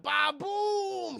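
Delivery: loud, high, drawn-out ba-boom; stretched explosion noise; imitated explosion. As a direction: boom out a slow comic blast.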